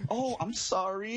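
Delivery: high, slow, overdone "oh, I'm sorry"; retelling his apology to a woman he misgendered; mock apology. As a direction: play innocent and sheepish. Apologizing to offended woman, retold.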